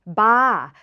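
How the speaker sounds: "ba" with no R, open ah; R dropped from bar, "ba"; Thai-style pronunciation. Shown as a demonstration. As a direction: say "ba" with a long open vowel. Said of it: "Thai people say ba".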